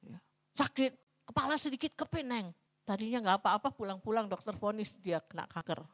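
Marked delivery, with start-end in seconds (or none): none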